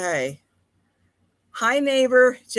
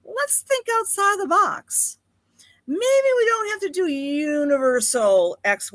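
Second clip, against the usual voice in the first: Saturn voicing an idea; 9 semitones higher than usual. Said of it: "Saturn in Aquarius says".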